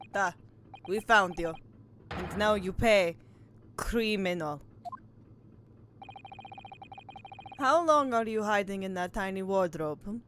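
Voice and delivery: accusing Russian sailor; terrible Russian accent. Acted. Player as Russian sailor confronting a caught stowaway, bad Russian accent. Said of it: "just a terrible Russian accent".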